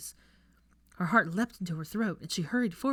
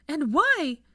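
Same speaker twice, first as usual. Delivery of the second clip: demanding shout; 10 semitones higher, 8 dB louder than usual. Acted.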